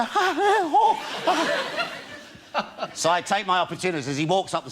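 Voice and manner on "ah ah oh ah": high squealing gasps through laughter; shocked gasps. Retold or imitated. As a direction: squeal like scandalised old ladies. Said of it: "all these women go".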